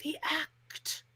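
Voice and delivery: drawn-out, hushed mock whisper; mimicking whisper. Podcaster mocking Cody's "act was easy" line, in a slow whisper. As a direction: whisper it slowly, pausing between words.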